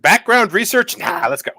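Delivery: high, loud brush-off; dismissive. Retold or imitated. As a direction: wave it away carelessly. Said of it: "And Hank is like".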